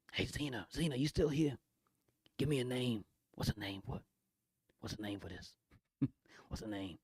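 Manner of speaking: quiet, soft asking; real quiet whisper. Real, not acted. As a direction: hush it down to a whisper. Whispering to chat.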